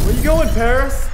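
high, loud mocking shout; taunting yell. Real, not acted. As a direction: jeer at the player for running off. Taunting beaten player.

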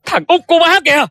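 high-pitched urgent cry; urgent yelling. Acted. Son announcing found younger brother to mother, urgent yelling. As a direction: call out the discovery, voice leaping up. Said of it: "mà mày la um sùm vậy".

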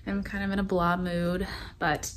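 low, soft, worn-out voice; exhausted quiet check-in, low energy; tired. Real, not acted. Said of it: "I'm exhausted. I'm very tired today".

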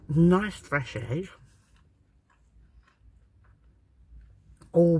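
words muffled by food; chewing while talking. Real, not acted. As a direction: mouth partly full. Seen 2: Bit sandwich at -7; lips pressed, chewing motions while describing it.

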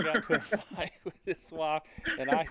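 laughing. Real, not acted. Telling hosts his buddy's sacrifice, laughing. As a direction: giggle through it, embarrassed.

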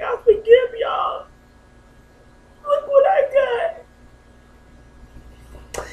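high, loud, slow cry through tears; crying out with joy. Real, not acted. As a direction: sob it out, overwhelmed with joy. Overwhelmed with joy at birth.

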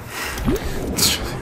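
short airy high giggle; a quick unvoiced laugh at himself; breathy giggle. Real, not acted. Gamer laughs at his own rambling, airy giggle.

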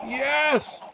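high, loud excited yells; frantic high yelling; urgent shouting. Real, not acted. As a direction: shout urgently as the plan falls apart. Urgent yelling during the chase.

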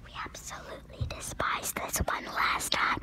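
breathy whisper. Real, not acted. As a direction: whisper it so others can't hear. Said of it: "You're too loud".

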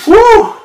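loud high woo; triumphant woo. Real, not acted. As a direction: whoop of relief at the end. Finishing the set.

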